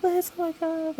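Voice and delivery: high, gushing "oh my God" from a stranger; surprised gushing greeting. Retold or imitated. Lady recognising her at the back, gushing surprise. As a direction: imitate a lady thrilled to see her.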